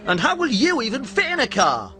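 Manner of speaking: high, boyish cartoon train voice; chirpy little train apologising; Stevie the steam train.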